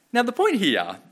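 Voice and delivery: ordinary delivery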